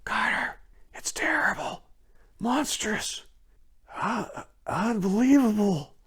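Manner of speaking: high, breathless terrified whisper; frenzied whisper. A narrator's reading. Warren to Carter through the phone, frenzied whisper. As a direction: gasp it out in horror. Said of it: "Then came the frenzied tones again".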